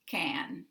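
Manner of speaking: can with the full open a, unreduced; full-vowel can. Shown as a demonstration. Teacher demonstrating the dictionary vowel to learners, full-vowel can. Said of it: "the full vowel a".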